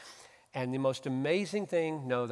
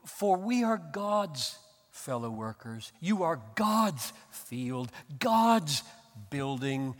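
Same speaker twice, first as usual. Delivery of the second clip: scripture reading; 3 semitones higher, 4 dB louder, slower than usual. Acted. Reading scripture verse aloud.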